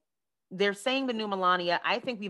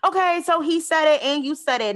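loud, high mock scolding; exasperated mom; 8 semitones higher, 7 dB louder than usual. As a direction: play a fed-up mother refereeing her kids. Acting the mother with her teenagers.

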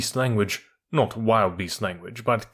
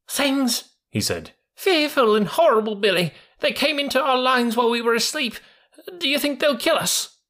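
put-on high, panicky young voice; a scared youngster, pitched way up; terrified young mule; 13 semitones higher than usual.

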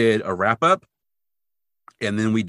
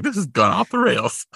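quoting co-host; 9 semitones higher, 4 dB louder than usual. Retold or imitated. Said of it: "where you're like".

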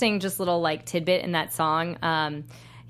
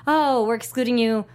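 higher, louder imitation of officials; mock backtracking; 5 semitones higher, 4 dB louder than usual. Religions suddenly including people they excluded, mock backtracking. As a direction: parody a convenient change of policy. Voices religions changing their rules.

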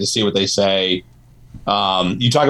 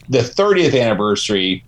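usual speaking voice

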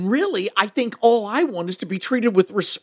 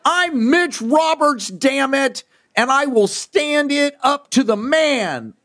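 pumped-up declaration; 7 semitones higher, slower than usual. Acted. Confident pep declaration.

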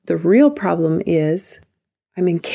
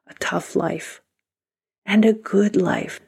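whispering. Real, not acted. Whispered ending phrase.

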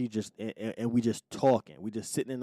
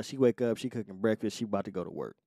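ordinary delivery

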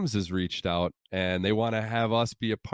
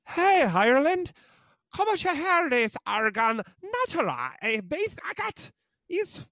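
high-pitched mangled Irish Gaelic ad read; hopelessly off Irish Gaelic accent; botched Irish Gaelic. Acted. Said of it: "Irish Gaelic here".

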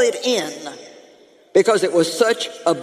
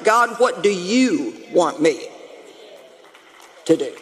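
sincere question; 3 semitones lower than usual. Retold or imitated. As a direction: ask it humbly, meaning every word. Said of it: "a more sincere question".

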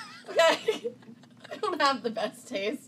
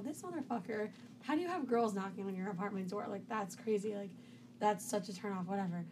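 much softer, under her breath; muttering to herself; 2 semitones lower, 10 dB quieter than usual. Retold or imitated. In his bed, hearing girls at the door, muttering. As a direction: grumble it quietly. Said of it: "I go".

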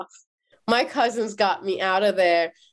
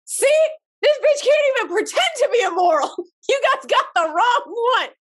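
cackling scornful guard; mocking guards; 11 semitones higher than usual. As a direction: sneer at the prude.

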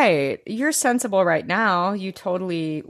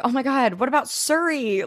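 mock Luna; 7 semitones higher than usual. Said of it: "She's like".

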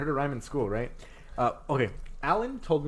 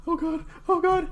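very high panicky squeak; mock dread. Retelling dread on entering class.

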